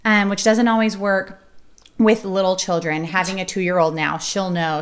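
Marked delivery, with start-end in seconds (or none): none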